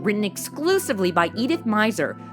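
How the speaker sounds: normal talking voice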